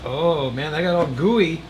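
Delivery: ordinary talk, nothing marked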